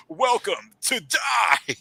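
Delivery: booming, drawn-out arcade villain shout; slow, high-pitched villain's proclamation; Magneto. Retold or imitated. Mimicking an old X-Men arcade game boss, slow villainous shout. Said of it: "Magneto and he goes".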